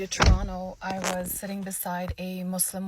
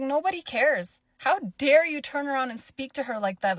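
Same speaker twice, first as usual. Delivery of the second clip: louder, higher indignant scolding; retold scolding; 5 semitones higher, 5 dB louder than usual. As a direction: tell her off in outrage. Speaker scolds rude lady.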